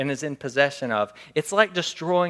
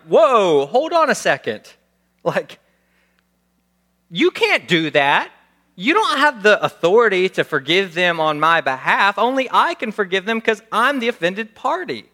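raised, louder protest, slowing on can't; a hint of an outraged driver; indignant objection; 5 semitones higher, 7 dB louder than usual. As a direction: push back, offended and incredulous. Acted indignant victim objecting.

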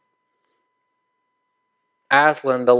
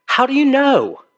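lightly voiced young boy, higher, quick and surprised; boy's startled question; 11 semitones higher than usual. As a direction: Shasta asking in disbelief. Shasta asks the voice.

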